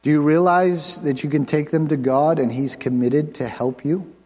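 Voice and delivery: usual speaking voice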